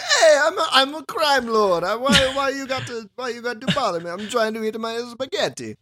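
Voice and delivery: high, complaining mock-Italian mobster; Italian-accent crime lord. Crime lord bothered while eating spaghetti, whining in Italian accent. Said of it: "you're just like".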